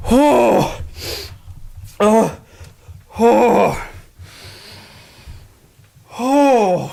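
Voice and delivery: dazed repeated oh after shock; shocked pained groans. Real, not acted. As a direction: groan in pain, reeling. Reeling after electric shock.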